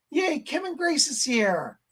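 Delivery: normal talking voice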